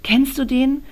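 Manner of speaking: nervously asking about the man across the room; anxious question. A narrator's reading. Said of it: "frage ich ängstlich". (leicht ängstlich, etwas höher gefragt)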